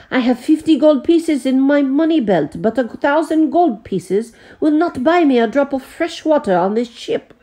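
high French voice, bitter and hopeless; despairing French. A narrator's reading. The Frenchman tells the butler his gold is useless, high and bitter.

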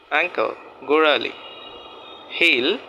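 ordinary talk

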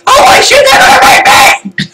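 very high, loud shriek; panicked scream. Pretend. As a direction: scream as if the chickens are attacking. Panicking as game chickens attack.

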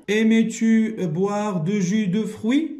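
slow, clear reading; slow example reading; reading aloud. Shown as a demonstration. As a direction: read the example to the class. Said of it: "reading example sentence".

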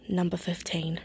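soft whispered aside. Real, not acted. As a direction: drop to a whisper for the number. Hushed label after admiring.

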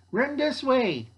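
high, urgent acted shout; urgent call. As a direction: call out to hurry the group along. Character calls out while fleeing.

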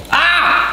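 very high yelp of pain. Real, not acted. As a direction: yelp at a sudden burn. Burned again by heat.

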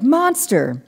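a second high alarm call; shout. A narrator's reading. Maddie repeating the alarm to the men, shouting. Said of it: "I shouted".

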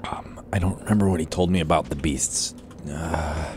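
unvoiced whisper to himself. Real, not acted. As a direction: murmur it under your breath. Confused aside while playing.

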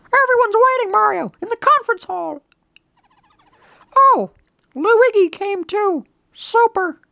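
very high, cheery cartoon voice; eager game messenger. Messenger summoning Mario and Luigi, high and eager. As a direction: chirp it in a squeaky character voice.

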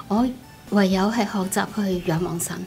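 ordinary delivery

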